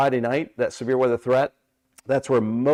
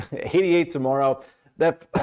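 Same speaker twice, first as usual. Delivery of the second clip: usual speaking voice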